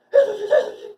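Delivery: very high, loud sing-song hey Sushi; high call. Shown as a demonstration. Trainer calls her dog Sushi in a very high voice. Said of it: "tone away like this".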